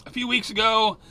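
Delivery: usual speaking voice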